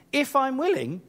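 high, incredulous repeat of the doubt; indignant. Acted. Jesus to the man who doubted his heart, indignant. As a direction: throw it back as an affronted question. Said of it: "That's why Jesus was indignant".

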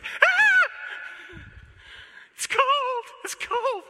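high, loud, hammed-up squeal at cold water; mock shriek. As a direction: shriek like a drop of cold water hit you. Acting shock at cold water.

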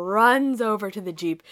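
ordinary talk, nothing marked

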